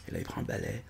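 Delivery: softly whispered narration. Acted. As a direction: whisper so no one wakes up. Narration à voix basse. (narration chuchotée)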